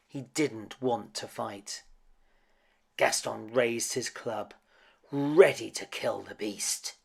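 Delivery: ordinary delivery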